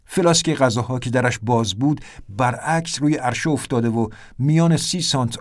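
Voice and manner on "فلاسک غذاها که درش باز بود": usual speaking voice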